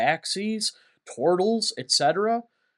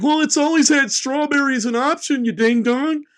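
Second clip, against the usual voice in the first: much higher, louder mocking whine; troll voice; 9 semitones higher, 5 dB louder than usual. Trolls in comments correcting him, high mocking whine. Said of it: "trolls in the comments who are like".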